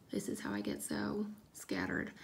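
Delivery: low unvoiced whisper to herself; a whispered aside about being scattered. Real, not acted. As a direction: mutter it under your breath. Self-deprecating aside.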